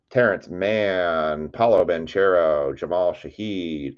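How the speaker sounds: ordinary talk, nothing marked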